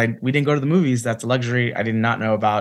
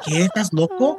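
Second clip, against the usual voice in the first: imitating shocked mom; 7 semitones higher than usual. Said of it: "She's like".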